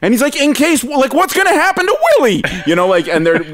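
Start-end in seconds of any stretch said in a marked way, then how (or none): 0.4-2.4 high-pitched mock questioning; imitated smart-aleck kid; wise-ass needling. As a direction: needle the teacher with a cheeky question. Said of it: "the fucking best wise ass".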